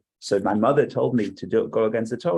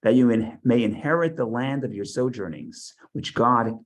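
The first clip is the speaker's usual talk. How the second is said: higher, louder solemn reading voice; scripture reading; 3 semitones higher, 3 dB louder than usual. Acted. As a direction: proclaim the verses to the class.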